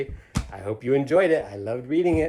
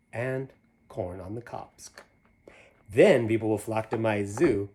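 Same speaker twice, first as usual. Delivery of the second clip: lively rhyming storybook read; reading to children; 4 semitones higher, 8 dB louder, slower than usual. A narrator's reading. Reading a picture book aloud to children, lively. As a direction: bounce through the rhyme for the kids. Reads Seuss rhyme aloud.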